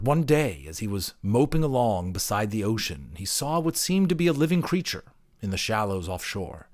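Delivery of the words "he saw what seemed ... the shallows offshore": plain reading voice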